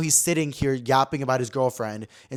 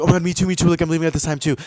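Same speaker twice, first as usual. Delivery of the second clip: higher, louder mock-eager guy; eager agreement; 4 semitones higher, 7 dB louder, faster than usual. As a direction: ham up his overeager excitement. Guy eagerly agrees to leave together.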